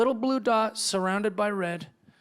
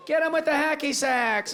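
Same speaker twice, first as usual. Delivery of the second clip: higher hipster war cry; mock battle order; 8 semitones higher than usual. Hipster orders hacky sacks against invaders, higher. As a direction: shout the hacky sack attack.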